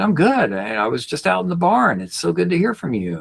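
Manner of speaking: dad's happy reply. Retold or imitated. Dad voiced in the retold call.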